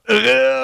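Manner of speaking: sudden high laugh at his own story; high burst of laughter. Real, not acted. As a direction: laugh out loud.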